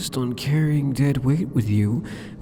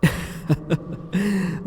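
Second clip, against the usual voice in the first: a higher teasing giggle; mocking laugh; 7 semitones higher than usual. Demon lord denying spying on the hero, teasing giggle. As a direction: laugh off his accusation.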